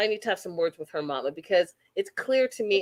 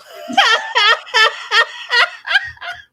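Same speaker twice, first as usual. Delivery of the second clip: big laugh; 7 dB louder than usual. Real, not acted. Laughing at own flub.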